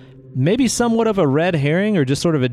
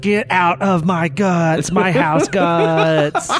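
very slow spooky moan with laughter; mock haunted house, stretched and laughing; ghostly house voice; 3 semitones higher, much slower than usual. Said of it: "the ghostly voice from the house goes".

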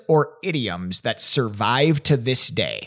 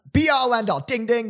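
higher, bright announcing tone; excited game host; 9 semitones higher than usual. Real, not acted. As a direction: announce the right answer like a game show. Game host awarding a point.

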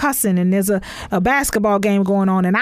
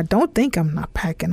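ordinary delivery

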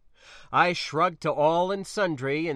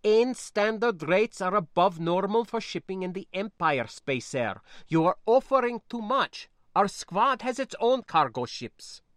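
put-on higher, louder accented young relative, remonstrating; objecting niece; 7 semitones higher, 4 dB louder than usual. Niece objecting over shipping rates, protesting. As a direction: argue the point as the foreign-accented niece.

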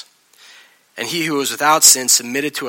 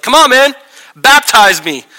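loud, high-pitched insistent yell; 10 semitones higher, 10 dB louder than usual. Retold or imitated. Jesus pressing John to baptize him, yelling. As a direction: shout it like a heated argument. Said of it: "were they yelling at each other".

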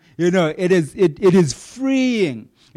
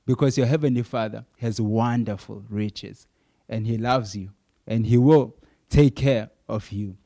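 gentle preaching; 5 semitones lower than usual. Acted. Soft preaching on God's care.

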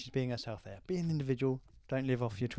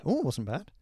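normal talking voice

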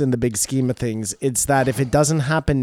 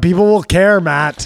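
loud insistent; 6 semitones higher, 7 dB louder than usual. Real, not acted. Heated disagreement.

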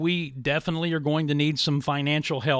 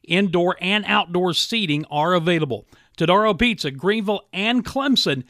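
upbeat, louder radio ad read; 4 semitones higher, 5 dB louder than usual. Acted. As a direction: sell it like a radio spot. Host reads live radio ad.

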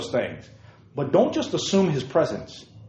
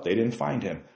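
usual speaking voice

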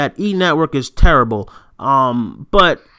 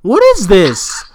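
annoyed outburst; 4 dB louder than usual. Retold or imitated. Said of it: "I was like".

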